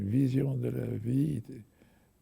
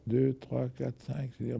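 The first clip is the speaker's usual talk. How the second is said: usual speaking voice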